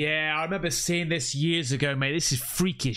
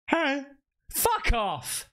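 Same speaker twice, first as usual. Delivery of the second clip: higher-pitched challenge to an intruder; mock confrontation; 8 semitones higher, much slower than usual. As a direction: call out to scare off whoever's outside.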